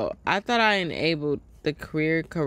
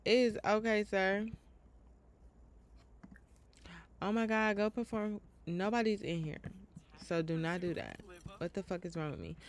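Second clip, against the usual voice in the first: much softer muttering to herself; muttering to self; 9 dB quieter than usual. Real, not acted. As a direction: mumble it under your breath.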